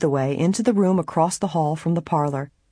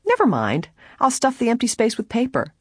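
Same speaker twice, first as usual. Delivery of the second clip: light, higher-pitched cheerful announcement; gaily; 8 semitones higher than usual. A narrator's reading. Helen offers to stuff the slippers with paper, gaily. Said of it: "Helen announced gaily".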